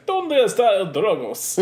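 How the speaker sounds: loud, high, hammed-up Spanish accent; mock Spanish accent. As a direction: bark it in a cartoon Spanish accent. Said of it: "he did Spanish".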